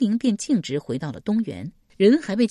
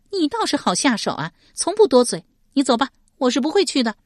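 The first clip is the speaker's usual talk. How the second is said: high, louder scornful female character; sharp-tongued woman, refusing; 8 semitones higher, 5 dB louder, faster than usual. Acted. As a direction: dismiss him in a high voice. 史佩 refuses the messenger. (高声冷嘲，断然拒绝)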